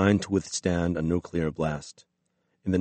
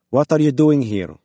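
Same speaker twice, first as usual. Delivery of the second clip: put-on local-accented demand, raised voice; local Russian accent; 7 semitones higher, 7 dB louder than usual. A narrator's reading. Woman with rifle questions intruders, local Russian accent. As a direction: challenge in a local Russian accent, loud and high. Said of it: "She had a local accent".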